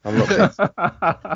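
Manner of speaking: high, slow, laughing protest; a hammed-up jilted ex insisting he's fine; mock bitter ex. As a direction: overdo the denial with a laugh. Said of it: "That's what an ex would say".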